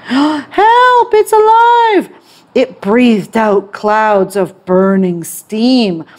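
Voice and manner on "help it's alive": terrified cry. Acted. Crowd panics at living dragon.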